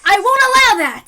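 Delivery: loud, high defiant cry; heroic shout. Acted. Coach bursting in to defend Sadako, loud heroic shout. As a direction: burst in to stop the villain.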